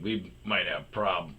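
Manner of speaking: usual speaking voice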